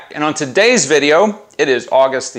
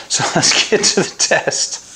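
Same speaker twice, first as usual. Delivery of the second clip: laughing; 4 semitones higher than usual. Real, not acted. Laughing through sign-off.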